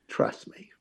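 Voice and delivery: soft breathy whisper; angel's whisper. Acted. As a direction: whisper calm reassurance. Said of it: "on that other shoulder was just".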